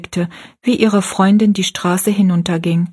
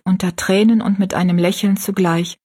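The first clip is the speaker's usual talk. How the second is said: usual speaking voice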